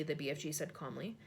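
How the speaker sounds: ordinary delivery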